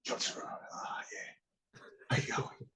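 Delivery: a soft, whispered Pierce greeting; imitating Pierce. Imitating Pierce greeting him on set in a soft whisper. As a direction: breathe it out like a scripted film line. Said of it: "It's like he's reading a script".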